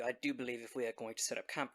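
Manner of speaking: ordinary talk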